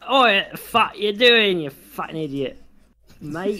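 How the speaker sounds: loud, high, drawn-out "oi" challenge; hammed-up rowdy heckle; mock aggressive shout. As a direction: pick a mock fight, starting loud. Acted rough challenge in retold story.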